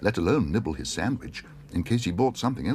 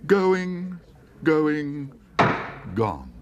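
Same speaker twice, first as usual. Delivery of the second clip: high, louder call falling on gone; auctioneer; 8 semitones higher, 4 dB louder, much slower than usual. Acted. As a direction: give up and close it. Auctioneer closing sale.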